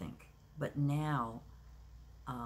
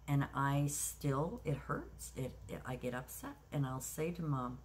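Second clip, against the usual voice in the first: ordinary delivery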